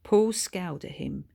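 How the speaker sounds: plain reading voice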